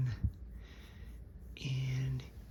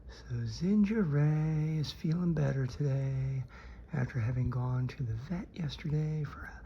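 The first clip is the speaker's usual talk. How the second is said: usual speaking voice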